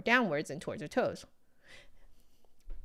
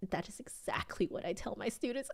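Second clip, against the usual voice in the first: whisper; 9 dB quieter than usual. Real, not acted. Aside while reading the book.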